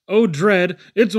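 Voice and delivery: loud, rising panic as the snake swallows; panicked cry. A narrator's reading. Child being swallowed by a boa constrictor, panicked cry. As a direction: cry out in alarm, cut off.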